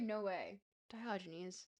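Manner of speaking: ordinary delivery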